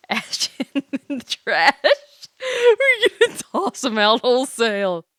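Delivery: giggling through the jokes; mock him while laughing; laughing tease. Real, not acted. Laughing at Ash.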